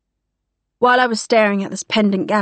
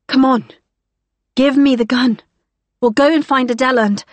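higher, louder, coaxing plea; urgent pleading; 4 semitones higher, 4 dB louder than usual. Acted. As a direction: urge her gently but fast. Pleading for the gun.

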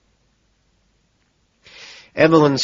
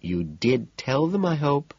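warning; 6 semitones higher, slower than usual. A narrator's reading. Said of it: "Courtney warns".

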